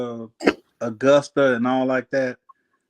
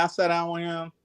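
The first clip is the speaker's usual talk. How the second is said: normal talking voice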